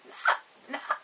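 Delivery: high thin voice breaking off; voice cutting off. Shown as a demonstration. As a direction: let the voice crack and cut out. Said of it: "see, it cuts off".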